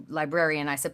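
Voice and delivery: ordinary delivery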